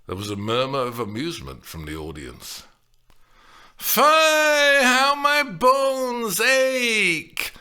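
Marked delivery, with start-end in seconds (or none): 3.8-7.5 put-on high, very slow, loud aching old nurse; the nurse complaining of aches; protested. A narrator's reading. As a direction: groan it out like old bones. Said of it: "Lynn protested".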